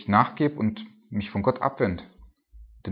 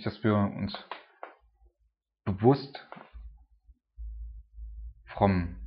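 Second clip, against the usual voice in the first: ordinary talk, nothing marked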